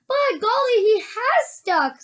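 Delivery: put-on high, amazed boy voice; young boy. A narrator's reading. Charlie seeing the boy stuck, high and amazed. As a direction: exclaim high, drawing out the end.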